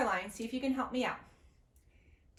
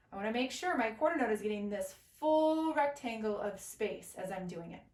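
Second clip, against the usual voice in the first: ordinary talk, nothing marked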